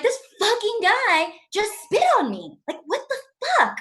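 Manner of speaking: acted outrage, high and exasperated; pissed off. Comic retelling a date who spat on her, pissed off. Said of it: "Like, I'm pissed".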